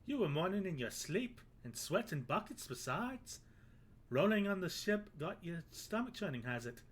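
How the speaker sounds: a hammed-up, horrible Irish brogue; bad Irish accent. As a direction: play the Irish sailor, badly on purpose. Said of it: "I've made him Irish".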